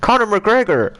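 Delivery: high, hammed-up Irish accent; badly faked Irish accent, about to laugh. Shown as a demonstration. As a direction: announce it in a mock Irish voice. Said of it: "I'll do my Irish accent".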